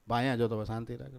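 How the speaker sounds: soft, low, easy answer; unbothered, dropping away softly; calm brush-off. Acted. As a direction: brush him off quietly. Calm answer to asking brother.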